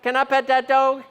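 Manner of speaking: a hint of an eager Appalachian child; little girl begging to pet the bear. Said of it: "cute video of the Appalachian girl".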